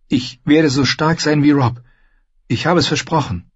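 put-on high, resolute young girl's voice; high-voiced girlish resolve; determined girl. A narrator's reading. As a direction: pitch it high and declare it like a promise. Girl voicing her resolve.

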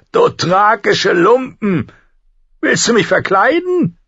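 very high, loud indignation; shrieked, scandalized disgust; outraged scorn. A narrator's reading. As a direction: spit contempt at the gift. Brother scorns the gift, outraged.

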